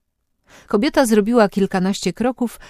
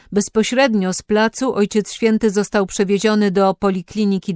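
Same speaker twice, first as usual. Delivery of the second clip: news bulletin read; 3 semitones higher, 3 dB louder than usual. A narrator's reading.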